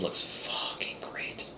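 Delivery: unvoiced whisper of a swear. Real, not acted. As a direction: whisper it so Twitch won't hear. Swears, asks if allowed on Twitch.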